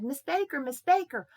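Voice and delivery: put-on high, loud, urgent boy's call; excited calling out. Boy runs upstairs to Miss Baker, excited calling out.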